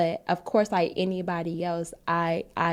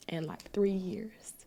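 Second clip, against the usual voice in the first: hushed, barely voiced confession; whisper; 8 dB quieter than usual. Real, not acted. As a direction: whisper it, ashamed to admit it. Admitting lost years, whispered.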